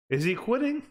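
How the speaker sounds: high, disbelieving question; incredulous. Real, not acted. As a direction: ask it again, stunned. Repeats, much higher, incredulous.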